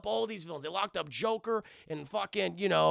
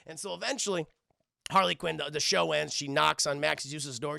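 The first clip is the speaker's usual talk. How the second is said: normal talking voice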